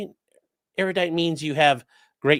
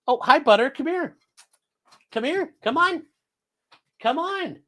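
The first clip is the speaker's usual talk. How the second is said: high pet-talk greeting; calling the dog; 12 semitones higher than usual. Real, not acted. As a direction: coo at the dog to come.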